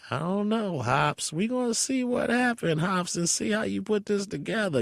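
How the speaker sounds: high raspy imitation of a friend. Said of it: "[name] talk with the raspy voice".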